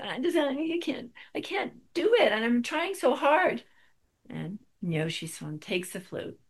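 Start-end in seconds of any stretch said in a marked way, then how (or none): 0.0-3.6 high, loud, whiny despair; frustrated pleading. Retold or imitated. Student complaining to the teacher, high frustrated pleading. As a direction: plead that you just can't.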